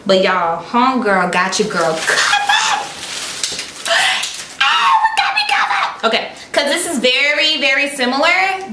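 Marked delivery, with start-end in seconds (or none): 1.9-6.0 very high laughing shout of joy; real overjoyed squeal; excited laughing shout. Real, not acted. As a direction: shriek it with delight. Thrilled reaction to clothes.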